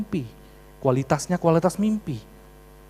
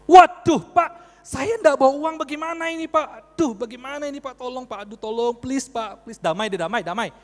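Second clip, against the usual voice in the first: panicked pleading; 8 semitones higher, 6 dB louder than usual. Retold or imitated. Motorist begging a policeman to settle the fine, panicked pleading. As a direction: burst out loud, then beg and beg.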